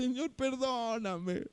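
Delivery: soft, slow plea for forgiveness; quiet prayer. Retold or imitated. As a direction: pray it softly on the train. Praying in the metro after sinning.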